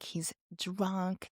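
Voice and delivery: ordinary talk, nothing marked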